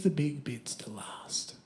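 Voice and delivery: whispered tip to the audience; confiding whisper. Real, not acted. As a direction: let them in on a secret.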